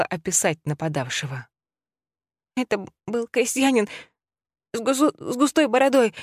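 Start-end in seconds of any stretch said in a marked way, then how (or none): 2.6-6.1 put-on sobbing child, high voice; high, tearful boy's voice through sobs; shaken tearful witness. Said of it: "всхлипывал Ника". (всхлипывающий мальчик)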